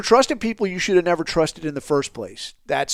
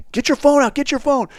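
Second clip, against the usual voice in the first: high, pressing command; retold urgent order; 11 semitones higher, 4 dB louder than usual. Interpreter telling the passenger to produce his phone, insistent command. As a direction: mimic an interpreter relaying a demand fast and firm.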